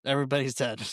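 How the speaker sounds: ordinary delivery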